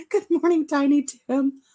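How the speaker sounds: high, bright hello; cheery greeting. Real, not acted. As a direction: greet a viewer warmly and high. Greeting a viewer.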